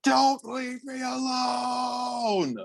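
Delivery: imitating a high, drawn-out guttural yell; guttural scream. Podcast host imitates boy screaming at his brother, guttural cry. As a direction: stretch it out slowly in a high mock scream. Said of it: "it's guttural, and I can't even do it justice".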